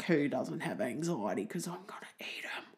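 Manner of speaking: sinister whisper. Acted. The lion, still hungry, whispering about his next prey, hushed menace. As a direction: breathe it like a predator scheming.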